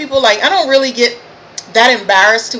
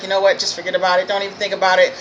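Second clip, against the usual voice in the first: low, softer talking-down to oneself; retold self-calming, pitched down; dismissive self-talk; 4 semitones lower, 6 dB quieter, much faster than usual. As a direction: brush it off under your voice.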